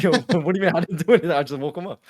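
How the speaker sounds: quick, loud protest through laughter; protesting innocence. Retold or imitated. Marine defending himself for waking his friend, protesting innocence. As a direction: re-enact a laughing, high-pitched denial.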